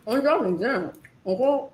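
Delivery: talking through a mouthful; mouth full. Real, not acted. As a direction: say it while chewing food. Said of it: "talking with my mouth full".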